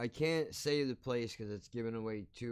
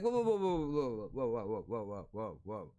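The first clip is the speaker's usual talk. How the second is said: halting protest; 4 semitones lower than usual. Retold or imitated. Speaker stopping his friend's apology, halting protest. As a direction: put the brakes on, then wind down.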